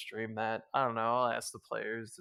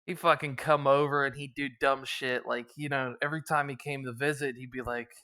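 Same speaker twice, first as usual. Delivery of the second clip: normal talking voice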